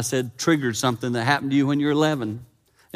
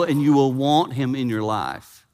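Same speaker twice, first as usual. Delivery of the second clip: normal talking voice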